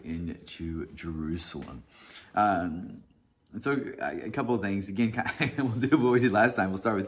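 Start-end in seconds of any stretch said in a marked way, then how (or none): none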